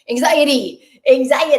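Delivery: mock audience calling out; eager crowd answer. Acting out surveyed people's eager answer.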